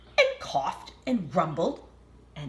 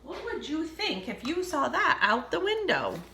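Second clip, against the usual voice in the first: ordinary delivery, nothing marked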